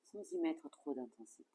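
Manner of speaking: ordinary talk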